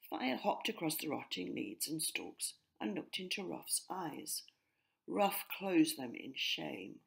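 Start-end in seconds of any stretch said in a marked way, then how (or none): none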